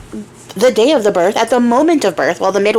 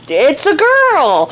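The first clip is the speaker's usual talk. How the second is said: mock midwife; 6 semitones higher, much slower than usual. Midwife announces the baby.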